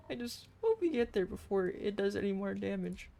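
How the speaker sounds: ordinary talk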